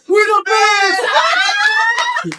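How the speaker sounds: high, loud, drawn-out cheer that dissolves into laughter; a drawn-out, joyful yell; excited shout. Real, not acted. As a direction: belt it out to your bestie and crack up. Playful shouted cheer between co-hosts.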